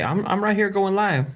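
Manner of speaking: excited. Real, not acted. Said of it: "I'm excited".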